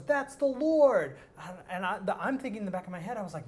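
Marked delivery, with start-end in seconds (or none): 0.0-1.2 slow, high, loud proclamation; retold yell. Youth pastor yelling into the boy's ear, slow and loud. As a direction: drag it out and shout it like a revelation. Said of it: "the guy who's yelling in my ear goes".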